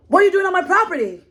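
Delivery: fast, high mock angry man demanding. Retelling the angry property owner confronting her, fast high mock anger. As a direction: imitate the angry landowner snapping at her.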